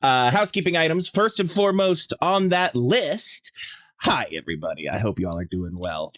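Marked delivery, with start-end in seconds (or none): 4.0-4.9 low, slowed-down heartfelt hello; sincere. Real, not acted. Streamer pausing to greet viewers, sincere. 4.9-6.1 low, softer, heartfelt well-wishing; sincere. Real, not acted. Streamer checking on viewers, sincere.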